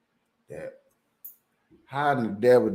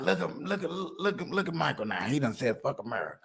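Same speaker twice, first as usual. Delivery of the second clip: quiet gossiping quote; 4 semitones higher, 7 dB quieter than usual. Retold or imitated. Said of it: "Somebody be like".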